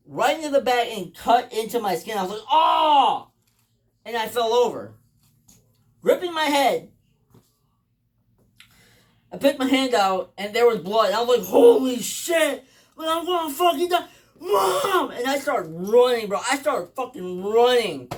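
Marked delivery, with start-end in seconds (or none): 2.5-3.3 loud, high "oh" on impact; acted-out cry at a sudden blow; yell of pain. Acts out being struck by hammer. 11.5-15.1 rising from slow shock to high wail; acted panic. Acts out panic at seeing blood.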